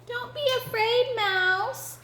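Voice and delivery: high, small kitten voice; high, gentle kitten coaxing; quietly reassuring kitten. Acted. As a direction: reassure the mouse as a little kitten. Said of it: "said Kitten very quietly".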